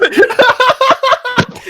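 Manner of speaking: a high burst of real laughter; high squealing laugh. Real, not acted. Laughs at story.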